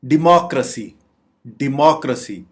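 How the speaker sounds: "डेमोक्रेसी" said di-MAAK-ruh-see; correct pronunciation. Shown as a demonstration. Teacher modelling the word, "डेमोक्रेसी" as di-MAAK-ruh-see. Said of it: "दुरुस्त English pronunciation बोलना".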